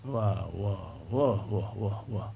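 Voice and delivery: low, muttering crowd murmur imitated; mock crowd uproar. Preacher mimics the shocked audience, low murmur. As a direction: rumble like an outraged crowd.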